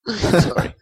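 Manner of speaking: usual speaking voice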